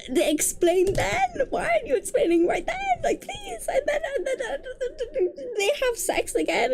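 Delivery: high-pitched, incredulous whine; exasperated rant. Real, not acted. Reader ranting at characters who explain too late, high exasperation. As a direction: voice climbing high in disbelief.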